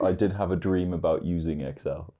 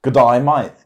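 louder, higher mock Australian greeting; Australian accent; 4 semitones higher, 8 dB louder than usual. Shown as a demonstration. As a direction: have a go at a broad Australian accent. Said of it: "do your very best Australian accent".

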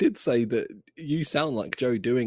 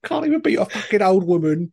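louder, higher jeering taunt; 7 semitones higher, 6 dB louder than usual. Retold or imitated. Bully jeering at the weakling, mocking taunt. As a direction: sneer it like a bully.

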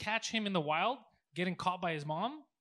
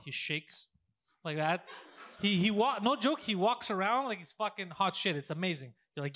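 ordinary talk, nothing marked

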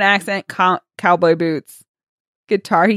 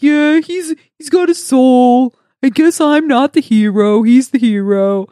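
louder, higher mock sulk; childish self-pity; 4 semitones higher, 5 dB louder than usual. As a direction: play a hero wallowing in hurt pride. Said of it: "he's being very childish".

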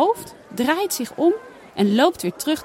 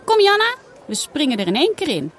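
excited call; 6 semitones higher, 3 dB louder than usual. A narrator's reading. Girl urging friend to jump.

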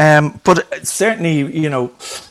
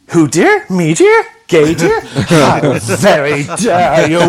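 louder, slow, hammed-up offended diva: "how very dare you"; camp mock outrage; 2 semitones higher, 6 dB louder, much slower than usual. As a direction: play the scandalised grand dame clutching her purse.

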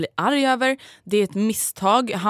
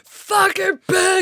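high, loud, very slow drawn-out curse; mock-yell like he's furious outside the restaurant; imitating angry yelling; 11 semitones higher, 5 dB louder, much slower than usual. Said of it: "han var ju skitarg och bara".

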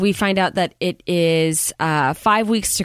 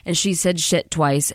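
ordinary talk, nothing marked

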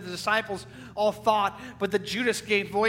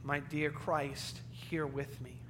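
Mary's inner thought; 6 semitones lower, 9 dB quieter than usual. Retold or imitated. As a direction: hush it as a private worry. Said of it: "And she thinks to herself".

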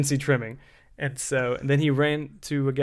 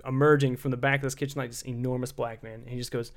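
usual speaking voice

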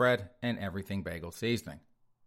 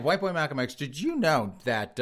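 ordinary talk, nothing marked

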